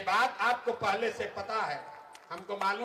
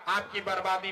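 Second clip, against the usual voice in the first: ordinary talk, nothing marked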